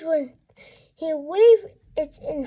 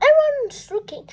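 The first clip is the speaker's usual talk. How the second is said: embarrassed scold; 9 semitones higher, 4 dB louder, faster than usual. Acted. Mom scolding in shop.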